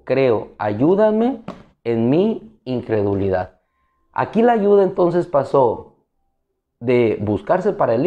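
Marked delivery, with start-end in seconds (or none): none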